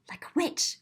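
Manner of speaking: gasp, then whisper like a witch; hushed, spooky aside; breathy whispered witch aside. Acted.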